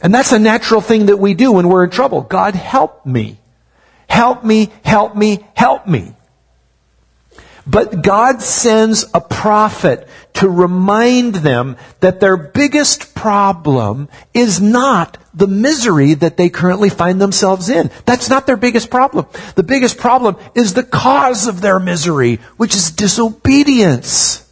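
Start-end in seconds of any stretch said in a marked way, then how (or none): 2.2-6.1 pleading cry for help. Retold or imitated. People crying out in trouble. 7.7-24.4 raised preaching. Acted. Preaching at full force.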